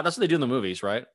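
ordinary talk, nothing marked

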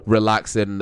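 ordinary talk, nothing marked

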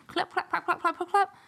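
high, rapid clip-clop of shoes; imitated footsteps. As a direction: patter out the little steps. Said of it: "Those are her little shoes".

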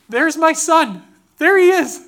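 loud, high burst of desperate hope; urgent exclamation. Retold or imitated. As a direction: cry out as if spotting the missing child. Retold thought on spotting a boy.

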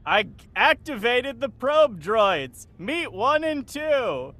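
loud, high, slow and triumphant; big drawn-out presentation; proud announcement. Acted. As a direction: strut in and announce it to everyone. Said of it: "comes strutting out and says".

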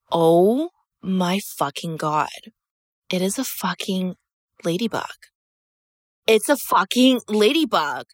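slow, stunned oh my God; stunned disbelief. Retold or imitated. Spotting the ladybug on the wall, slow stunned awe. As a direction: let it sink in, slowly, in awe. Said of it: "and I'm like".

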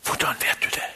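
breathless, amazed question; a stunned near-whisper; astonished whisper. Retold or imitated. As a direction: whisper it in disbelief. Woman stunned by his words.

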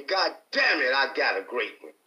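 ordinary talk

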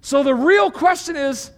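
high, loud preaching voice driving each point home; full-force preaching. Acted. Pastor preaching the fruit of the Spirit, at full force. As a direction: preach it out big to the whole room.

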